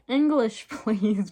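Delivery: high, loud mock moan; moaning complaint. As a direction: whine it out with a laugh. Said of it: "Bugsy moaned".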